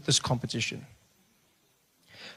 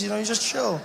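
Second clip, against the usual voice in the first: ordinary delivery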